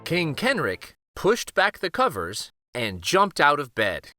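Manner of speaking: ordinary delivery, nothing marked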